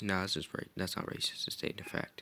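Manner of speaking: whispered under the breath; muttered aside. Real, not acted. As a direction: mutter it quickly to yourself. Muttered self-defense.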